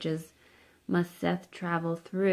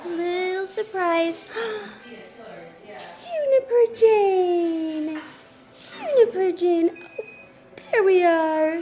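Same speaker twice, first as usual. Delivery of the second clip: squealing with joy at a newborn; excited cooing; 10 semitones higher, 8 dB louder than usual. Real, not acted. Grandma meets newborn grandchild on camera.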